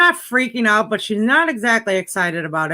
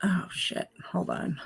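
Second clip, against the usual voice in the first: whispered curse; 3 semitones lower, 13 dB quieter than usual. Real, not acted. Dropping phone, muttering.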